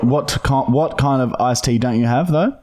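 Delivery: ordinary talk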